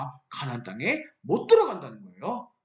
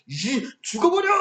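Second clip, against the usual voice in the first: angry shout; 4 semitones higher, 3 dB louder than usual. Acted. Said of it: "화를 냈어요".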